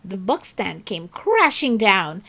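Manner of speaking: ordinary delivery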